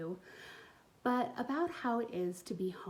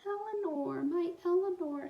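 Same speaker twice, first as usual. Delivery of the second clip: put-on grieving child; 9 semitones higher, 3 dB louder than usual. Hazel grieves torn doll.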